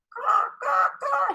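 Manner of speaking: high shouted caws; crow caws. A narrator's reading. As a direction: call out like a happy crow. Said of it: "shouted the crow".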